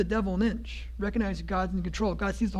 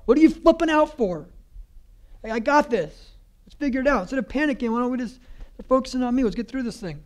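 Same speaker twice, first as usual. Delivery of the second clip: higher, louder, casual reassuring Jesus; voicing Jesus; 5 semitones higher, 4 dB louder than usual. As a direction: play Jesus telling you to chill. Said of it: "Jesus is like".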